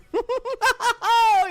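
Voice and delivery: high squealing cackle; shrieking laugh. Acted. Bursts out laughing mid persona.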